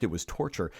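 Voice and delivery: ordinary delivery, nothing marked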